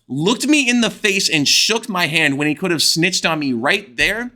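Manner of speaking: normal talking voice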